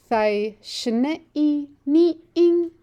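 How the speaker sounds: "Thai shne i ni ing" slow, with the rise on "ni" made plain; clear tonal model. Shown as a demonstration. Teacher asks students to hear the tone, slow tonal model. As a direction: let the tones ride up so they can hear them. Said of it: "listen to the tone in my voice".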